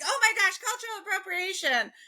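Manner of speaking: hammed-up scandalised voice.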